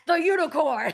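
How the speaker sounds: punchy, excited payoff; excited reveal. Real, not acted. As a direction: announce it with delighted hype. Hyping the national animal.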